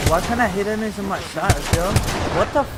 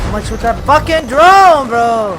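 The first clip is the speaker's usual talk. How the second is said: much louder, higher yell; calling from far away; 7 semitones higher, 14 dB louder, slower than usual. Real, not acted. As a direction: yell it across the field. Yelling mid-fight about drone.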